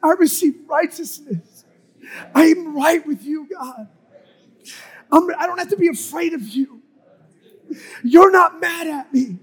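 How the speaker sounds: loud, high, fervent proclamation; impassioned declaration prayer. Real, not acted. Preacher leading congregation in prayer to receive righteousness, impassioned. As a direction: declare each phrase with full conviction.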